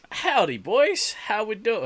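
high, slow drawn-out cowboy howdy; cowboy accent. Pretend. Host slipping into a cowboy accent to greet the boys. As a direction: greet them like a cartoon cowboy. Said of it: "I don't know why I put on a cowboy accent".

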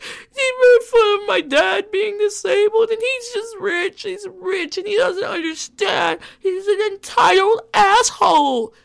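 high, loud mock sobbing rant; mock crying. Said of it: "This bitch was over there crying".

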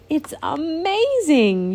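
high, slow, marvelling cry; amazed exclamation. Acted. Genevieve hearing the magic music, slow and amazed. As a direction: let the wonder stretch out. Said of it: "Genevieve exclaimed".

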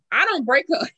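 ordinary talk, nothing marked

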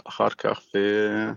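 normal talking voice

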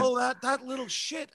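high, loud exasperated outburst; a loud, high "that little shit" of disbelief; exasperated exclamation. Retold or imitated. Father staring at a dent in his car, exasperated and loud. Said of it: "I was like".

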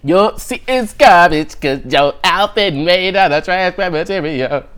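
high, slow roast-battle voice, playing to the crowd; imitating a comic roasting someone, drawn out; mock roast. Comedian on Wildin' Out roasting a contestant, hammed-up high voice.

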